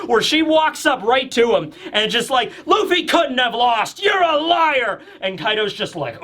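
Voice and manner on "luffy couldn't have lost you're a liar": high, drawn-out acted protest; hammed-up outraged cry; defiant accusation. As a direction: hurl it in the villain's face. Nami confronts Kaido, acted.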